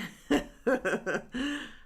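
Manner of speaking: high snorting laugh; real snort laugh showing it off. Real, not acted. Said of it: "snort laugh with the best of them".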